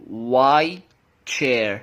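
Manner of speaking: drawn-out WHY, then CHAIR, no T; linked, T dropped. Shown as a demonstration. Teacher applying linking, WHY CHAIR without a T. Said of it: "لما هاجي اطبق اللينكينج هتلاقي نفسك بتقول".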